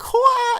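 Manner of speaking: high, loud imitated martial-arts yell; mock kung fu "hua" shout; kung fu cry. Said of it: "like, like kung fu move".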